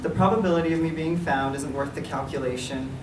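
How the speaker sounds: ordinary delivery, nothing marked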